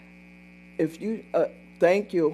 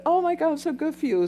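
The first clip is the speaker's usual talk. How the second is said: much higher, gushing; answering the coworker's news, much higher and gushing; her own excited reply; 10 semitones higher, faster than usual. Retold or imitated. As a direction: squeal it like happy news. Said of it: "So I said".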